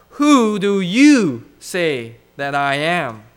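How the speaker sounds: slow, emphatic question, weight on YOU; emphatic challenge. Retold or imitated. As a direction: press YOU hard and long, then let the rest fall. Climactic challenge posed to congregation.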